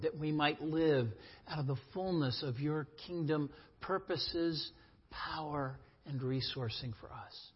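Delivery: soft, hushed pastoral prayer; quiet reverent prayer, easing into a brisk close. Acted. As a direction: pray it softly over the people. Closing prayer before the sermon.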